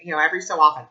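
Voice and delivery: ordinary delivery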